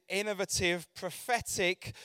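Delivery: ordinary talk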